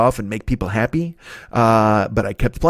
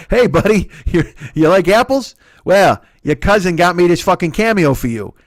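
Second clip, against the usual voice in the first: put-on a loud, higher, rough try at a Boston accent; hammed-up Boston guy, louder; 5 semitones higher, 5 dB louder than usual. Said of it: "I can't do a Boston accent, but I'll try".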